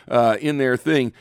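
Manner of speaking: ordinary talk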